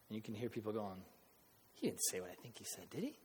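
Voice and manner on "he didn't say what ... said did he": very soft, under the breath; low murmur lifting into a question; hushed disbelief. Retold or imitated. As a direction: imitate a shocked onlooker's murmur. Shocked hearers muttering to each other.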